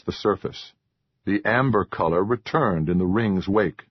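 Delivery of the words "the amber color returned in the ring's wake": plain reading voice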